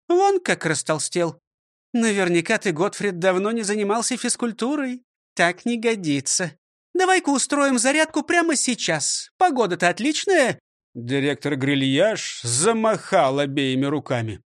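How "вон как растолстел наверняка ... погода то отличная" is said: higher, scolding schoolmistress voice; stern old teacher. Acted. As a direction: lecture him like a strict teacher. Teacher orders exercise. (строгая учительница)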